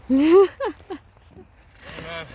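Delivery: loud exhausted groan of words. Real, not acted. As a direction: belt it out, spent.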